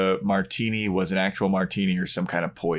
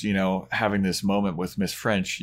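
ordinary delivery

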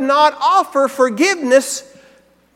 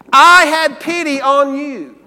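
slow and louder, "I" pressed hard in "I had pity on you"; emphatic reading; 3 semitones higher, 7 dB louder, slower than usual. Shown as a demonstration. Preacher ending the master's rebuke, slow and emphatic. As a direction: land the last line like a verdict. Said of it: "notice the parties that he emphasizes".

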